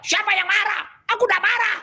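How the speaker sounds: high, indignant mock shout; angry denial. Spouse asked whether they are angry, acted angry shouting. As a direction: act out an obviously furious denial of anger. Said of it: "itu marah padahal kan".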